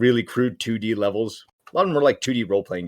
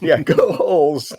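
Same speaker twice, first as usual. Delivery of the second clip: drawn-out, higher playful cheer; mock team cheer; 5 semitones higher, 4 dB louder, slower than usual. Backing the holes in a paper war, mock cheering. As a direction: echo the chant slowly and playfully.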